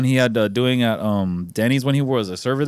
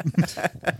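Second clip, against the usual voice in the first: weird strangled laugh; 4 semitones higher, 5 dB quieter than usual. Real, not acted. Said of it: "That was a weird sound I just".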